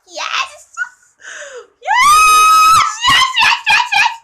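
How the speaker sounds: very high squeal building to a scream; ecstatic fangirl shriek. Real, not acted. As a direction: shriek it over and over in delight. Fan excitement over the costume.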